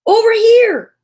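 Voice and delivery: put-on high, loud child's shout of joy; overjoyed yell. A narrator's reading. Child calls the family over to Douglas, yelling overjoyed. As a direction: call out in delighted discovery. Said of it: "yelled an overjoyed child".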